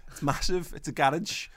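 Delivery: a hint of a posh little girl bragging; posh kid accent. Dad imitating daughter's posh A on "massive", lightly slowed. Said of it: "It's like".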